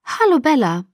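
high soprano voice. A narrator's reading. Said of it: "ihre hohe Sopranstimme".

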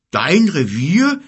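high, loud, drawn-out outrage; indignant bear. Acted. Bear disputing whose territory it is, indignant. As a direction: echo it back slowly in loud disbelief. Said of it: "empörte sich der Bär".

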